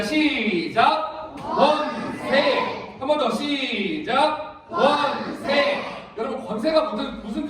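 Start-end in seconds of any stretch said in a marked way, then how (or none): 0.0-2.9 very slow, drawn-out group chant; read-aloud cue. Shown as a demonstration. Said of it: "큰 소리 읽겠습니다". 3.0-5.8 very slow, drawn-out group chant; read-aloud cue. Shown as a demonstration. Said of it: "큰 소리 읽겠습니다".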